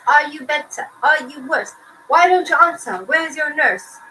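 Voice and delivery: hammed-up doctor demanding answers; doctor's voice. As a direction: bark it like a bossy doctor.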